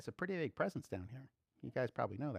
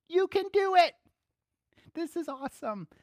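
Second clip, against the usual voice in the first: high, loud encouraging cheer; 13 semitones higher, 8 dB louder than usual. Pretend. Family in heaven cheering us on, excited. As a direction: cheer us on from above. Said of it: "they're like".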